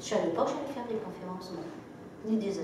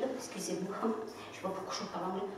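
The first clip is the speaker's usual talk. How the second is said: softer, sheepish apology with a laugh; embarrassed apology; 9 dB quieter, faster than usual. Retold or imitated. Apologising to a client for speaking English, softly embarrassed. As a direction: murmur an awkward excuse.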